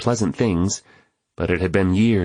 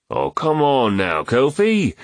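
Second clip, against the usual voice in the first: slow, higher-pitched objection; mild protest; 8 semitones higher, slower than usual. A narrator's reading. Teased man answers his friend, mildly protesting. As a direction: draw out a good-natured protest. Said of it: "Reuben protested".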